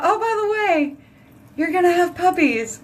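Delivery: high, sing-song reveal of big news; spring the surprise on him playfully; a bright, teasing reveal of puppies; teasing announcement. Real, not acted.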